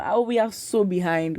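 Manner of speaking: ordinary talk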